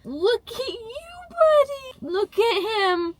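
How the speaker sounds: high, slow cooing pet voice. Real, not acted. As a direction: coo at your puppy. Cooing at her dog.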